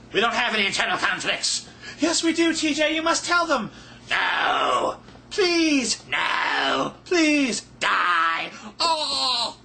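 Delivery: squeaky high voices bickering, shouting and crying out; inner voices arguing. Pretend. Internal conflict voices squabble, high and shouting. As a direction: act out a shrill fight between inner selves.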